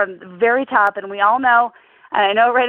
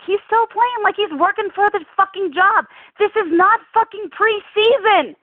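heated rant; 7 semitones higher than usual. Real, not acted. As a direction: vent it, pitch climbing with frustration. Fan rants at the quarterback.

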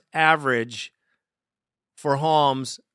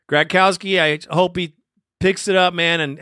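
ordinary talk, nothing marked

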